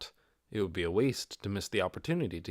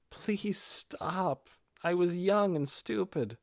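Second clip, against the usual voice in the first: higher, younger voice begging them to stop; flustered and mortified, higher; embarrassed plea; 6 semitones higher, slower than usual. Acted. Ninya pleading with teasing friends, embarrassed and higher. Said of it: "Ninya's face was turning a shade of red".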